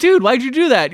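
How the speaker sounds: high, loud indignant question; mock protest. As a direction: protest like a baffled kid brother. Brothers protesting the kick.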